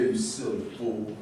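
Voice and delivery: usual speaking voice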